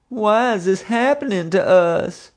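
very high, slow hammed-up wail; bawling. A narrator's reading. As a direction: wail it out in despair. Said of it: "he bawled".

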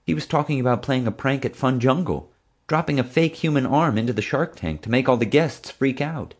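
high-pitched, pleading explanation; anxious helpful friend. A narrator's reading. Friend trying to bail the narrator out, anxious. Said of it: "trying to bail me out".

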